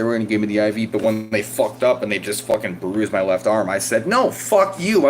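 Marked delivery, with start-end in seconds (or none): 4.1-5.1 high, drawn-out indignant no; angry refusal. Retold or imitated. Patient to staff who bruised the arm, angry refusal. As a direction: refuse hotly, voice jumping up.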